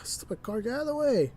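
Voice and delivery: high frustrated shout at a car; annoyed yell. Real, not acted. Bus driver yelling at a car blocking the way, frustrated.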